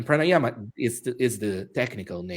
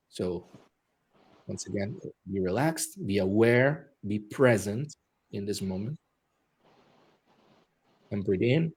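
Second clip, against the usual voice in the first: guided meditation; 2 semitones lower, 5 dB quieter than usual. Acted. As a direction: guide them calmly through the breath. Leads breathing meditation.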